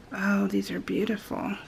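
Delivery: awed soft whisper. Real, not acted. Admiring the alphabet charms for viewers, whispered. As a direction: breathe it in admiration.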